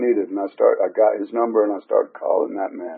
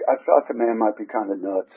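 ordinary talk

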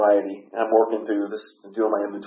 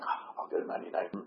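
quick, hushed mumble of giving in; grudging surrender; 4 semitones lower, 12 dB quieter, faster than usual. Retold or imitated. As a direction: give in under your breath, quickly. Retold grudging giving in to sponsor.

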